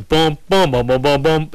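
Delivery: mock tuba oompah, steady beats; imitated tuba booms. Host keeps the tuba going for a bad game, mock tuba booms. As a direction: thump each boom like a tuba. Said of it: "Get the tuba playing".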